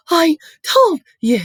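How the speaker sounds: high, loud gasped words with gaps; gasping. A narrator's reading. Hermione with a stitch in her chest, gasping. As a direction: heave out each word slowly, out of breath. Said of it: "Hermione gasped".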